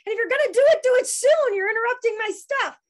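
loud, high-pitched mock complaint; shouting out. Daughter imitating dad complaining at dying roommate, shouting out. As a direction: imitate dad yelling his annoyance. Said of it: "shouting out stupid shit".

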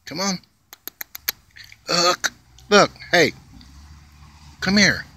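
high, sing-song calling to a squirrel; coaxing pet talk. Real, not acted. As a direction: coax the little animal over, pitch way up.

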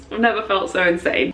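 ordinary talk, nothing marked

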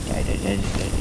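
low growly chant of die; a lightly put-on deep monster voice chanting; deep mock monster voice. Gamer taunting enemies in a deep monster voice.

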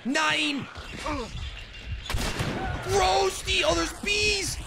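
high, sustained mock-panic yelling; hammed-up cry. Gamer under attack by bees, high hammed-up yell.

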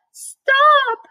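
very high, loud shriek; screamed. A narrator's reading. Wilbur interrupts the old sheep's account of killing, screaming. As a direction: cut in with a piercing piglet scream. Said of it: "screamed Wilbur".

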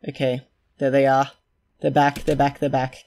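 loud, urgent calling out; excited shout. Real, not acted. Calling the colonists back inside, loud and urgent. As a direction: hurry them in, thrilled. Duplicants return, rushing them in.

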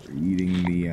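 ordinary delivery

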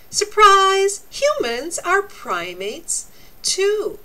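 high, loud playful reveal; surprise reveal. Acted. Teacher to children revealing humans are primates, excited. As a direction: pop it out like a surprise party.

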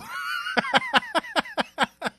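long laugh, very high; real high cackle trailing away; high-pitched laugh. Real, not acted. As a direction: let out a squealing laugh. Laughs at own mock alarm.

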